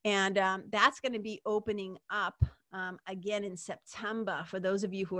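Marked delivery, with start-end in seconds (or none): none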